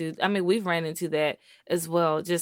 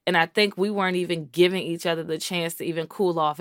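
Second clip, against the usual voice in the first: normal talking voice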